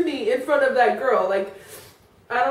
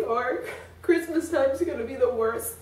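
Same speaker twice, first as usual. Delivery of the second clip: normal talking voice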